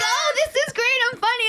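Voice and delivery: high excited near-shout, playfully self-pleased. Retold or imitated. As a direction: squeal it out like thrilled with yourself. Retelling her excited reaction.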